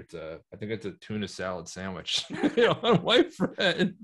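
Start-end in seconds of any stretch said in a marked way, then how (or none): 2.3-4.0 high, slow words through laughter; real laugh breaking into the words. Real, not acted. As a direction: crack up while finishing the phrase. Cracking up over a photo.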